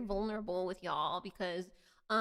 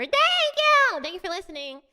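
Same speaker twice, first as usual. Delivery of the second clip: excited thanks; 10 semitones higher, 8 dB louder than usual. Real, not acted. Thrilled reply to viewer compliment.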